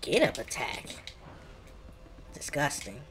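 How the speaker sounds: normal talking voice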